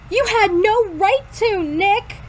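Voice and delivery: high-pitched, restrained protest; mild yell. A narrator's reading. Girl telling Nick he had no right, mildly yelling. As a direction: scold with pitch lifted, volume held. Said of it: "she yells back".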